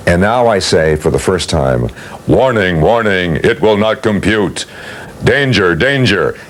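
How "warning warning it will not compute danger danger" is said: even, measured robot warning; mechanical robot voice. Shown as a demonstration. Auditioning for the robot voice for Irwin: mechanical robot delivery. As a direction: intone it like a machine. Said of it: "in my best mechanical robotian sound".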